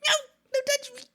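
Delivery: high squeaky frog voice; voicing the frog. Streamer voicing the frog fleeing the cat, squeaky. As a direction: squeak it as a panicked little frog. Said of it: "And frog was like".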